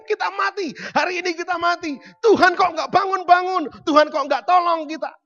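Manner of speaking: high, loud, panicked wail; panicked cry. Acted. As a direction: cry out in terror on a sinking boat. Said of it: "Mereka mulai panik". (jeritan panik yang keras dan tinggi)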